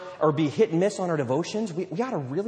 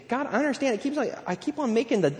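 higher-pitched sheepish plea; acted confession, pitched up; confessing believer; 6 semitones higher than usual. Believer admitting repeated bad choices to God, sheepish plea. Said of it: "and we say".

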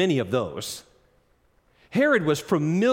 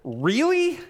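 incredulous; 5 dB louder, much slower than usual. Retold or imitated. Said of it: "he says".

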